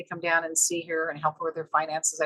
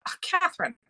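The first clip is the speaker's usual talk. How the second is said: self-scolding; 11 semitones higher than usual. Retold or imitated. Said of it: "I thought".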